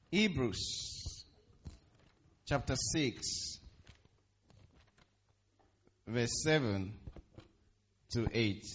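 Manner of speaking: slow, with a long pause after each piece; emphatic repeat. Real, not acted. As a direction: spell out the reference piece by piece, unhurried. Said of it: "Just to emphasize that point again".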